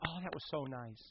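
very soft, lightly voiced coo; imitated admiring churchgoers. People responding to a testimony, softly imitated admiration. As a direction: murmur it like a touched congregation. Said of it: "people are like".

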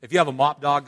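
usual speaking voice

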